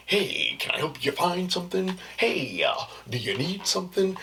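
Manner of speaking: upbeat customer service voice; chirpy shop assistant voice; work voice. Acted. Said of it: "Like, my work voice".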